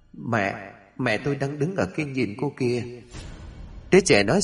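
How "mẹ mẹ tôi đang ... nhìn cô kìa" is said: put-on soft, eerie demon child, hushed; sinister low voice. Demon baby pointing out its mother, hushed and sinister. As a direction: murmur it like a ghost pointing behind you. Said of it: "tiếng nói âm trầm phát ra".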